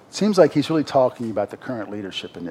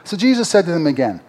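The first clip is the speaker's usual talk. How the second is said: raised, ringing preacher voice reading Jesus' words; scripture reading; 3 semitones higher, 4 dB louder than usual. Acted. Preacher to congregation, reading scripture with full force. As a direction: proclaim the verses like from a pulpit.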